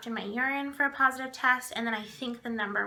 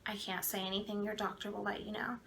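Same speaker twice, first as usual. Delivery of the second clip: no emotion; 2 semitones lower, 9 dB quieter than usual. Retold or imitated. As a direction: say it quietly, giving nothing away. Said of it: "No emotion".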